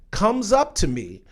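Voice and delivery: ordinary talk, nothing marked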